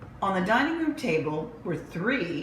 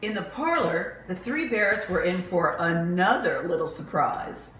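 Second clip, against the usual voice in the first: usual speaking voice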